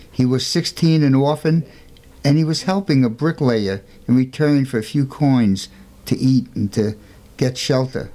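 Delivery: ordinary delivery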